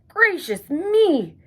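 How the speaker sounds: high, slow exclaim of surprise. A narrator's reading. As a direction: gasp it out amazed. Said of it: "he said in surprise".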